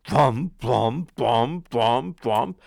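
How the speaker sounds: loud, heavy thump on each word; booming thumps. A narrator's reading. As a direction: stamp out each thump like giant steps. Giant's footsteps approaching.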